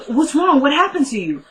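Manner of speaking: high-pitched worried questioning; acted alarm. Friend questions the injured Kelly, acted alarm. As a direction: imitate a friend's alarmed concern.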